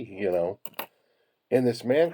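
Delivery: normal talking voice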